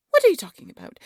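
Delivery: loud, high indignant snap; furious royal rebuke; angry outburst. Acted. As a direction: snap at her, outraged. Queen angrily dismisses servant's claim.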